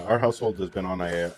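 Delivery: ordinary talk, nothing marked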